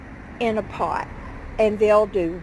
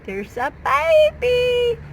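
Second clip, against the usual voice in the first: high, slow cooing voice; 14 semitones higher, 5 dB louder than usual. Real, not acted. Gardener coos at baby succulents, high baby talk.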